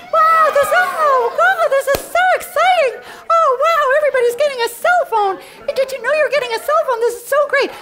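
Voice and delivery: high, loud, quick hammed-up excitement; mock squeal. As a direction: overdo the thrill, tongue in cheek. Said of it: "come on, squeal, humor me".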